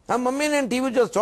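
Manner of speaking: lightly voiced child asking mother; child's plea.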